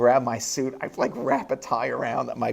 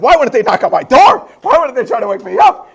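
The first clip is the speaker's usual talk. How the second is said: higher, louder indignant complaint; indignant blaming; 10 semitones higher, 12 dB louder than usual. Retold or imitated. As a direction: blame them out loud, fed up. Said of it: "I even started blaming them".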